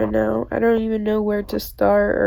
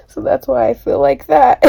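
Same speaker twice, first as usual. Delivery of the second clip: tearful laugh; 4 semitones higher, 7 dB louder than usual. Real, not acted. Laughing at own loneliness.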